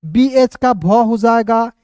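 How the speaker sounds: slow, letter sound drawn out; teacher showing a letter sound slowly; slow careful explaining. Shown as a demonstration. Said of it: "भालू भी आ गए".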